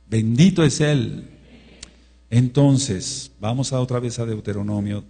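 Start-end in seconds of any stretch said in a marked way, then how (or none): 0.1-1.3 Bendito es Él proclaimed louder and slower; louder blessing. Shown as a demonstration. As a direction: raise the voice on Bendito es Él, drawn out.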